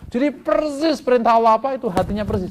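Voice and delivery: loud, high, emphatic sermon; forceful preaching. Real, not acted.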